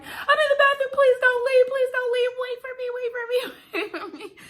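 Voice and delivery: very high mock shriek, loud at first; frantic calling out, imitated. Patient in bathroom to epidural guy, acted frantic yelling. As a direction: yell a panicked, repeated plea through a door.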